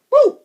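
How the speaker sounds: high loud cheer after a high five; cheering woo for a little friend; excited woo. Real, not acted. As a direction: whoop with delight.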